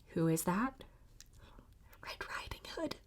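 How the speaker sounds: hushed secret whisper. Acted. Reader answering children, secret whisper. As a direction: tell them in a hush.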